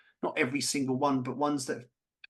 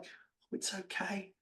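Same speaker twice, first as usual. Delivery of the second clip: whispered, meek it's okay; mocking the forgiving victim in a whisper; 5 semitones higher, 11 dB quieter, much slower than usual. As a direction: breathe it out like a doormat. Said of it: "Why would you be like".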